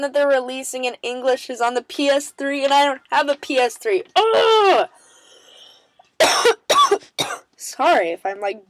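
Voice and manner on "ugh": loud high ugh of frustration; exasperated loud ugh; frustrated groan. Real, not acted. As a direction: whine out the disappointment. No PS3, frustrated.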